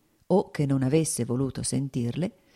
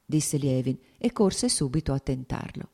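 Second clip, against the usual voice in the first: ordinary delivery, nothing marked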